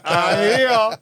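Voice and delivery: laughing, put-on Dublin drawl; Dublin accent. Shown as a demonstration. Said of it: "do you wanna hear his dub accent?".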